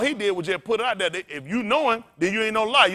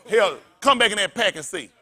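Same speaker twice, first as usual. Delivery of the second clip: ordinary talk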